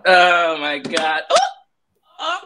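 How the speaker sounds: teary acceptance speech. Pretend. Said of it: "Big Blue, go ahead".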